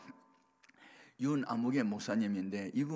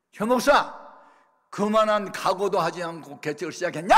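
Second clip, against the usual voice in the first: loud, high retold reprimand; angry scolding; 7 semitones higher, 10 dB louder than usual. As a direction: shout it like a senior pastor telling off a junior. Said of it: "막 화를 내면서". (화내며 야단치듯 크게 호통치는 말투)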